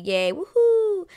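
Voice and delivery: high, loud, drawn-out whoop; mock happy cheer. Recapper imitating the happy newlyweds, mock cheering. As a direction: cheer slowly with a rising whoop. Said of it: "they're all happy".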